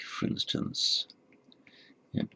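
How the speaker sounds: ordinary delivery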